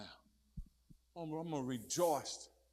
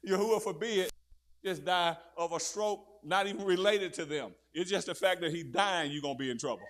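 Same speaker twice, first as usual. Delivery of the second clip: emphatic preaching; 4 semitones higher, 12 dB louder than usual. Real, not acted. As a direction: hammer the point with force.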